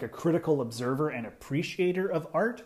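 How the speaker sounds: usual speaking voice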